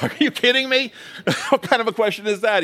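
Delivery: high, loud disbelief with a laugh; amused mock outrage at the question; incredulous. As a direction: scoff as if the question is absurd. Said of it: "incredulous mock reply".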